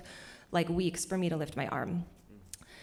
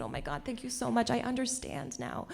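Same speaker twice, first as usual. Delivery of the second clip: soft, higher, mock-tearful thanks; tearful gratitude; 5 semitones higher, 6 dB quieter than usual. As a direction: imitate being moved to tears with relief. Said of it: "I started crying right there".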